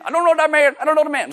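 loud, very high-pitched hillbilly; hillbilly accent. Acted. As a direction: blurt the denial in a comic hillbilly voice. Hillbilly voice repeated for comedy.

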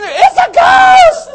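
very high, loud, slow shriek; full-throated hammed-up terror; terrified scream. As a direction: scream it drawn out in panic. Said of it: "and they scream".

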